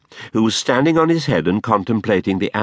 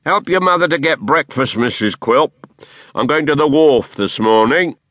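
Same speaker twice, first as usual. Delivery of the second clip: higher, louder commanding dwarf; bossy dwarf; 6 semitones higher, 4 dB louder than usual. A narrator's reading. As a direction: order her about. Quilp orders his wife.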